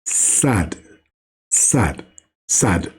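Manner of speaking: "Sad" said low and deep; pronounce Sad. Shown as a demonstration. Teacher demonstrating the letter Sad to a child, low. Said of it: "c'est la lettre".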